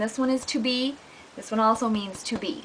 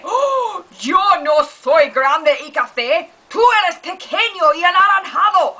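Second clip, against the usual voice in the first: higher, louder offended retort; outraged cartoon animal; 6 semitones higher, 6 dB louder than usual. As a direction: yell the insult back. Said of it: "this is what it would sound like".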